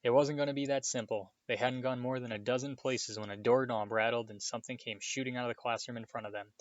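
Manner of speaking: ordinary delivery, nothing marked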